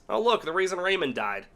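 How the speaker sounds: usual speaking voice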